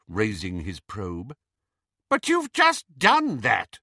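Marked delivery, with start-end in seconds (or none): 2.1-3.8 high, loud and slow, full of haughty command; commanding, arrogant voice. A narrator's reading. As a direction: look down on him and command. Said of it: "in Bellatrix's commanding, arrogant voice".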